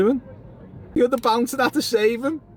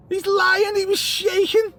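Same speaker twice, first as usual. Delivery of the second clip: raised, insistent pitch; excited jeering; 7 semitones higher than usual. Real, not acted. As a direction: crow about catching him out. Excited heckling of someone shaken.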